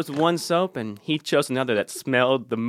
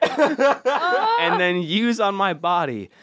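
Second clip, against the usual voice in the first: laughing while reading; 10 semitones higher, slower than usual. Real, not acted. Reading review, cracking up.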